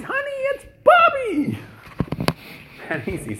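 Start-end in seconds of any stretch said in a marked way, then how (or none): 0.0-1.6 very high, loud cry of recognition; shocked cry. Retold or imitated. Father sees his estranged son at the door, a very high, loud cry of surprise. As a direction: shout the name in disbelief.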